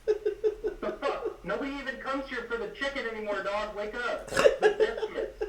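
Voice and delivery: high, laughing rant, slow breathy on the biscuits; laughing high rant about biscuits; laughing outburst. Real, not acted. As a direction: crack up while scolding Popeyes. Ranting about Popeyes biscuits.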